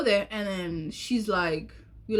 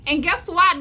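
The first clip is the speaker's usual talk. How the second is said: higher, louder guess what; excited tease; 8 semitones higher, 8 dB louder than usual. Real, not acted. Telling the audience about his text, an excited tease. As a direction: pitch it up to tease the twist. Building to the twist.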